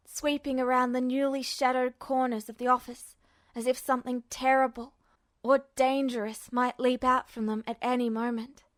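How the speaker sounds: ordinary delivery, nothing marked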